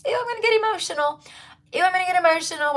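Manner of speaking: high, fast squeaky voice. Real, not acted. Getting teary over viewers' love, high fast squeak. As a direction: squeak it out to dodge the tears. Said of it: "Why am I saying ew?".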